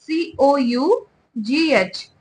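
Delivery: slow, letter by letter; spelling it out. Shown as a demonstration. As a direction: spell each letter for the class. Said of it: "spells the word letter by letter". (धीरे-धीरे अक्षर बोलते हुए)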